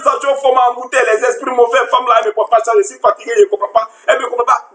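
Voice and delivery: high-pitched acted complaint; exasperated rant. Fed-up spouse saying the wife never understands, acted exasperation. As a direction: rant as if at wits' end. Acts an overwhelmed spouse ranting.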